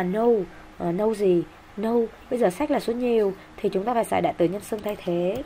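ordinary delivery

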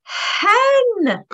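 teacher cheering the answer; excited reveal. Acted. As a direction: celebrate the answer.

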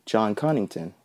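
ordinary delivery, nothing marked

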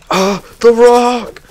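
drawn-out cry of love for the rock; ecstatic cry. Pretend. Rock-obsessed cry in game.

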